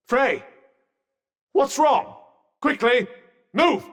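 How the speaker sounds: high, sharp calls of alarm; urgent alarm. Acted. Calling to partner in danger.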